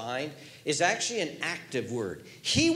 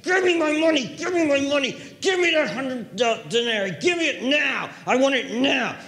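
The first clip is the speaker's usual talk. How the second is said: much higher, louder shouted demand; furious demand; 10 semitones higher, 7 dB louder than usual. Acted. Servant shouting at choking debtor, furious. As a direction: yell it, hand on his throat.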